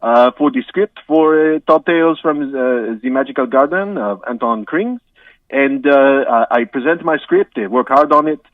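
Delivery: hammed-up French accent, halting with uhs. Guest apologises to the host in a mock French accent. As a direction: play a comic Frenchman stumbling through English.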